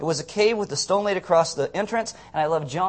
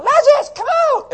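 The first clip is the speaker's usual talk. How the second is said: put-on very high child's shriek of the name; little girl screaming; 10 dB louder than usual. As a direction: scream it like an excited little daughter. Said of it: "she'd just scream it".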